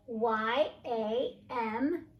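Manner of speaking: slow, letter by letter; spelling slowly. Shown as a demonstration. Teacher spells story words, slow and clear. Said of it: "teacher spells word slowly".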